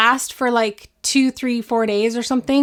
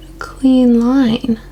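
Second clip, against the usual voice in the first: concentrated whisper; 4 dB louder, much slower than usual. Real, not acted. Concentrating while drawing brows.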